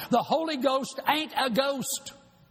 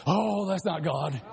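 low, mumbled brush-off; lightly voiced skeptic waving it away; mock dismissive churchgoer; 6 semitones lower than usual. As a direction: shrug it off like a doubter. Mocking a doubting churchgoer.

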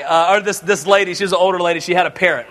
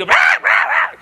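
parrot squawks. Acted. Parrot shut in the freezer, frantic squawks. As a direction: squawk wildly like a trapped bird. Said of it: "the parrot's just going crazy".

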